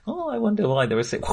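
high, mock-innocent wondering. Mimicking a horror victim's last panel, mock wonder. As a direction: play the doomed victim, blithely curious. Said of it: "is like".

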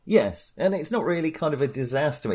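normal talking voice

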